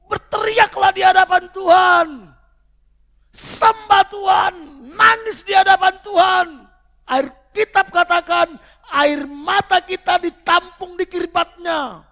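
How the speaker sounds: high, full-throated preacher's shout; urgent exhortation, shouted high and loud; full-force preaching. Real, not acted. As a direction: cry it out to the whole church at the top of your voice.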